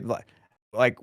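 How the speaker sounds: ordinary delivery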